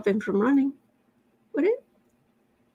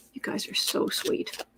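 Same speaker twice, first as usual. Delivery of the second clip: soft whisper; 10 dB quieter than usual. Real, not acted. Thanking chat warmly.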